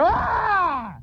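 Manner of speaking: sudden high-pitched scream. Acted. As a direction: shriek as it blows up. Shower explodes.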